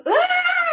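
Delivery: high piercing mock scream, loud; a jokey fake shriek of shock. Mock shock at Bob's reply.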